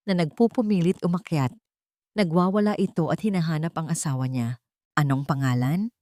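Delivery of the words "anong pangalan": low questioning CEO. Acted. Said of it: "tanong ni Henrique".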